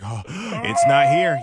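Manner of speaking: high, drawn-out dismay; shocked and slow. Retold or imitated. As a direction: stretch it out in horror. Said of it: "you're like".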